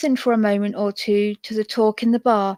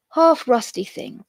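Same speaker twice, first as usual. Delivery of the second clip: plain reading voice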